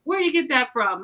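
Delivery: host mom voice. Retold or imitated. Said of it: "she would be like".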